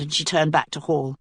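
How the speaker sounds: plain reading voice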